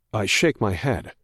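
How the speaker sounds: plain reading voice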